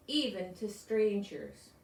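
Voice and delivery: ordinary delivery, nothing marked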